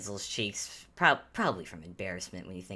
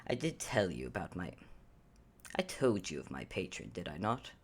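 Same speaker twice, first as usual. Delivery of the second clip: dry Ansel; 4 semitones lower, 7 dB quieter, faster than usual. Acted. Ansel voiced by GM.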